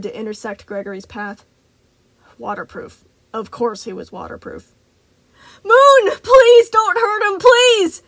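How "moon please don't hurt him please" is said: very high, very loud desperate howl; howling plea. Acted. Freddy howling at Moon not to hurt Gregory. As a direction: scream it across the room, begging. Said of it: "Freddy howled".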